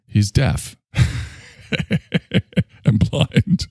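cracking up at own joke; real laughing. Real, not acted. Host amused by his own deaf, blind superhero, laughing. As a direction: laugh through the words, helplessly amused. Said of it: "when I make myself laugh".

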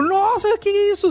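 high astonished cry; mock shock at the twist; shocked gasp.

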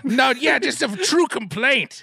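high ghoulish voice; Crypt Keeper. Acted. Ghoul admits to roommate he means it, Crypt Keeper voice. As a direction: stay in the high creature voice, drawing out the end. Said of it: "the Crypt Keeper".

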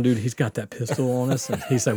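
usual speaking voice

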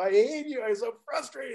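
high, whiny mock frustration; mock frustrated. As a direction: play up a childish tantrum. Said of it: "I go".

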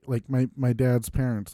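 normal talking voice

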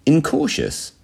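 high, careful INCAUTIOUS after a pause; delicate understatement. A narrator's reading. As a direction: set the word down gingerly. Spy delicately finishes his admission.

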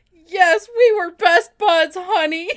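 loud mock sob. Acted loud emotional line.

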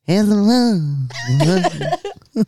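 slow drawn-out [name] mumble; [name] impression. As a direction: stretch it out like early Dylan. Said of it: "That was my [name]".

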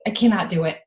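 pretending to panic about going pee; mock potty fear. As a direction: play a kid too terrified to use the toilet. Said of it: "I'll be like".

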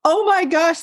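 a jolt of recognition; startled. Retold or imitated. Patient hears that the angry spirit is her ex-husband, in a startled cry. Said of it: "And I'm going".

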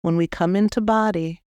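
normal talking voice

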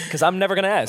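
ordinary talk, nothing marked

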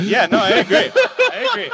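high, giggly concession; real laughter through the words; laughing agreement. Real, not acted. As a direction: agree while cracking up. Laughing at own blame.

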